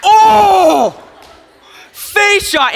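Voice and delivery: high, loud yelp of a shout; gleeful shout. Real, not acted. Reliving the face shot for the crowd, a loud gleeful shout. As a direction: yell out in shock and glee.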